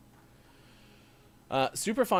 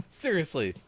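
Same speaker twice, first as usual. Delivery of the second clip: usual speaking voice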